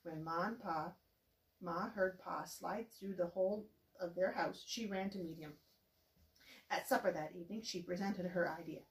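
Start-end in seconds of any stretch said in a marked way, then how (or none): none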